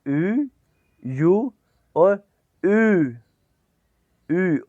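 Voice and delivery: यू with pursed lips, the last one slow; यू drawn out as ü; u-umlaut demo. Shown as a demonstration. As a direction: say यू as ü, lips tight. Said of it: "अब यू को".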